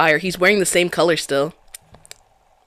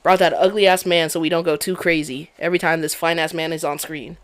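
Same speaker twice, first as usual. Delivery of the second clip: usual speaking voice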